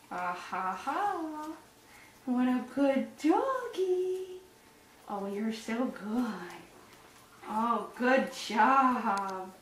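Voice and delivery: slow, cooing praise for the dog; slow sing-song pet praise; doggy praise talk. Real, not acted. Praising the dog during brushing.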